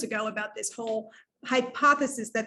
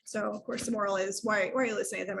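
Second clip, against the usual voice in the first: ordinary talk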